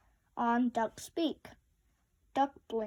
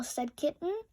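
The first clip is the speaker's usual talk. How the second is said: usual speaking voice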